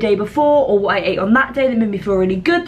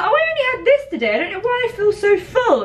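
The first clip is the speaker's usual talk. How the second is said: mock clueless voice; 10 semitones higher, faster than usual. Mimicking a forgetful eater.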